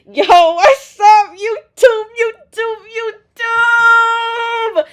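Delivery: loud, high, stretched YouTube greeting; hyped greeting. Real, not acted. Vlogger greeting YouTube viewers, loud and high-pitched. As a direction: shout it out to the camera like a hype intro.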